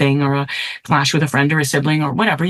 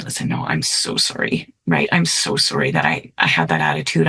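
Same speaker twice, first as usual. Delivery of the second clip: much lower, soft, hushed apology; tender apology; 14 semitones lower, 3 dB quieter than usual. Retold or imitated. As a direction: gently say sorry to a hurt child. Apologizing tenderly to her son.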